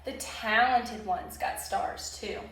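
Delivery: usual speaking voice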